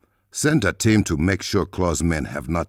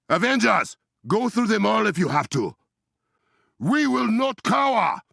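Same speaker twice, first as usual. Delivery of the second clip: rallying shout. Acted. Rallying allies in combat.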